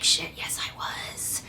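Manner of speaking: hushed guilty whisper. Retold or imitated. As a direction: breathe it out with no voice. Her guilty admission in retold scene.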